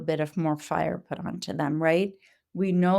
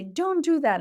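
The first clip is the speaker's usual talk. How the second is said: mild scolding; 8 semitones higher than usual. Retold or imitated. Adult scolding the biter.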